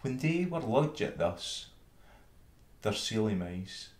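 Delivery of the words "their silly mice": plain reading voice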